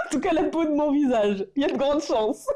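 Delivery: high-pitched, giggly delivery; laughing. Real, not acted. Vlogger joking about ruining her face with cheap makeup, laughing. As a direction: talk through the tail of a real laugh.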